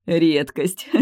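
mocking snort, word rising; snorting laugh. A narrator's reading. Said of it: "прыснул рядом сидящий эльф".

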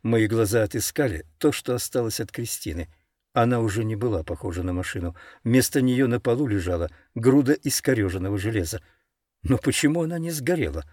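usual speaking voice

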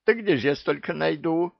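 protest, outraged; indignant. A narrator's reading. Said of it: "возмутился Джевдет".

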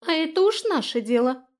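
high, loud little fairy voice; high-pitched echo of the retort; fairy backing her up. A narrator's reading. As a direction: chime in defiantly as her ally. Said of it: "поддержала её Иса".

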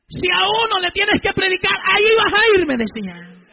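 loud, emphatic proclamation; full-force preaching. Real, not acted. God promising to back him, retold in full-force preaching.

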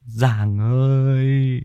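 high, slow, quavering terrified wail; sobbing villager voice; whimpering. Acted. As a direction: blubber out the news in fear. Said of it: "liền mếu máo".

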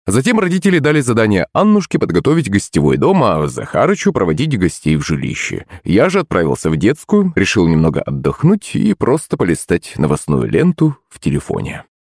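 usual speaking voice

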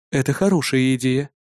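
ordinary delivery, nothing marked

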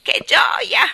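high, loud cry of joy; breathless exclamation. A narrator's reading. Starling exults after his capers, breathless exclamation. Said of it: "esclamò ansante".

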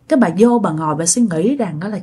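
ordinary delivery

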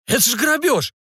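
high-pitched outraged protest; indignant. Acted. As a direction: protest the offer, pitch shooting up. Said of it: "возмутился тот".

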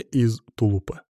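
ordinary delivery

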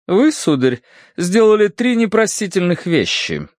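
stern high-pitched lady. A narrator's reading. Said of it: "сурово заявила Серафима Маркеловна".

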